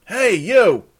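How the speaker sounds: loud, high shout for attention; calling out. Acted. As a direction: holler across to someone out of sight.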